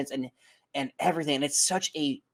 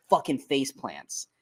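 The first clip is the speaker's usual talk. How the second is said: usual speaking voice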